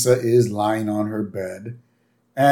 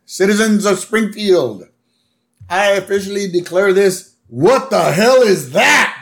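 grand mock proclamation derailed by shock; Mayor Quimby; 7 semitones higher, 3 dB louder than usual. Mayor addressing citizens at the squid port, hammed-up orating. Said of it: "Mayor Quimby".

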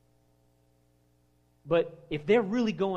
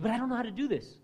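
whiny excuse; 6 semitones higher, faster than usual. Retold or imitated. Mocking excuse-maker.

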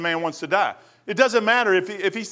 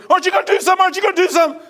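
panicked boss; 10 semitones higher, 5 dB louder, much faster than usual. Retold or imitated. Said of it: "he goes".

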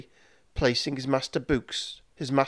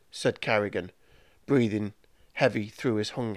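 usual speaking voice

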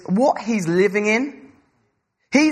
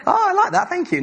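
laughing mock delight; 12 semitones higher than usual. Mock delight at passing exam.

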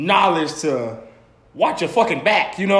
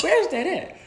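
high, baffled question; high-pitched bewildered asking; confused; 13 semitones higher than usual. Retold or imitated. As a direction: sound completely lost about where it is. Lost, confused about the location.

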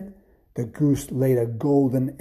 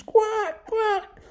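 very high goose quack; squeaking goose. A narrator's reading. Goose grabbed off the giant's table, squeaking high. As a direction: squawk like a startled goose. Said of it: "the goose squeaks".

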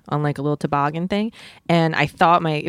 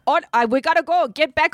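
put-on higher-pitched stab at an African accent; badly faked African accent, urgent warning; attempted African accent; 10 semitones higher than usual. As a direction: imitate a safari guide with a failed African accent. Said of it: "I can't do an African accent".

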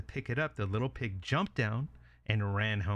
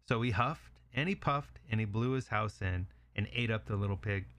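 plain reading voice

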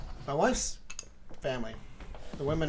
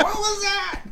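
high, startled little voice; mock-alarmed baby asking what that was; slower than usual. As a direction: play the baby spooked by its own noise. Baby startled by own fart.

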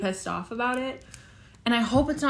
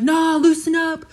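put-on jeering old men; 7 semitones higher, 6 dB louder than usual. Dream men laughing in her face, imitated jeering. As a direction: imitate a taunting crowd, pitched up. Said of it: "laughing in my face, being like".

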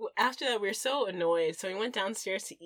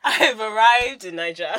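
laughing mock triumph; 2 semitones higher, 11 dB louder than usual. Laughing echo of the quote.

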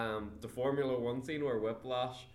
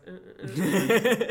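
laughing dance grunts; 9 semitones higher, 11 dB louder, much slower than usual. Retold or imitated. Imitating Justin Hammer's dance.

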